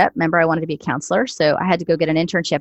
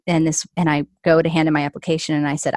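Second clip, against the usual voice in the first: normal talking voice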